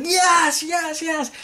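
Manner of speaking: very high, gushing fan cheer; retold fanboy ecstasy; fantard mode. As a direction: squeal it like an overjoyed fan. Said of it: "I was going all fantard mode".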